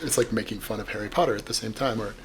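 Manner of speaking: ordinary delivery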